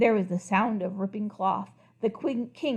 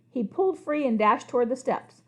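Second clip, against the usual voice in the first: ordinary delivery, nothing marked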